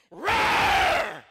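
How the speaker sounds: long, very loud roar in a full voice; big manly roar, held out. Retold or imitated. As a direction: roar like a grown man. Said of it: "not in that manly voice".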